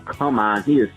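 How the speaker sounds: ordinary talk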